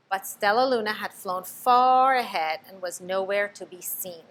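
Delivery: ordinary delivery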